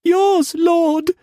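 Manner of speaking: very high, small whimpered answer; whimpering. A narrator's reading. Mei Mei answering Struan about the child, whimper. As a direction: whimper it fearfully. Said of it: "she whimpered".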